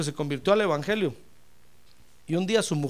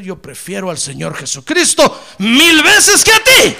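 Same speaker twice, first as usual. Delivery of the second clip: impassioned declaration; 4 semitones higher, 14 dB louder, slower than usual. Acted. Husband choosing Christ over his wife, impassioned shout. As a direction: build to a high, slow shout.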